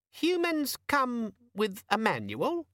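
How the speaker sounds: high, slow, puzzled squeak; hesitant squeaky demon. A narrator's reading. Demon wondering at Vimes's claim, puzzled squeak.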